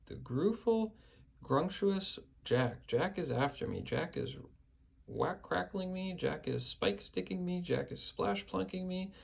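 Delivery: high, stammering panic; terrified giant. A narrator's reading. Dreaming giant raves about Jack, high frightened babble. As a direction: babble the fears high and shaken. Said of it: "bellowed the flesh lump eater".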